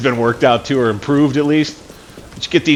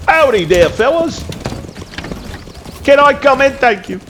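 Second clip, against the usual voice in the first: put-on country accent; 13 semitones higher than usual. Greeting traders in character voice.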